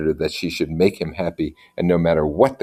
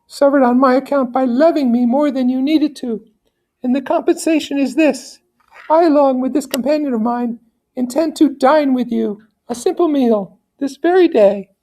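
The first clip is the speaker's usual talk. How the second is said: soothing her sick son in a much higher voice; high gentle lady voice; 4 dB louder than usual. Acted. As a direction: promise it like a loving noble mother. Lady speaks to Federico.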